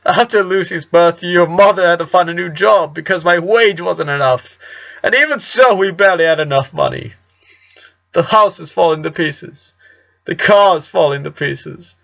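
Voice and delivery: a father pouring out his money worries; distressed father. Acted. Father laments money troubles.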